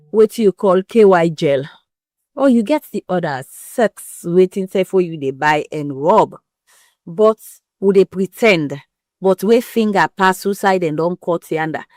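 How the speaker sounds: usual speaking voice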